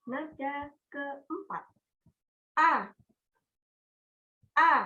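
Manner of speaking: A said in each of the four tones, one by one; four Mandarin tones. Shown as a demonstration. Teacher showing students the four tones on a syllable. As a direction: demonstrate tone one through four on "a". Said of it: "lanjut kalau nada pertama".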